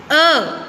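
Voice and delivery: short, distinct अ; repeat-after-me अ. Shown as a demonstration. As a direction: model अ for pupils to echo. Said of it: "दोबारा से बोलिए".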